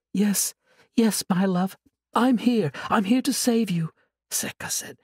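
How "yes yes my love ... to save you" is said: sweet, higher and innocent; angel voice. Acted. Sorceress faking an angel's voice to lure her captive, sweetly. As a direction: coax him like a gentle angel. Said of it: "voice of an angel".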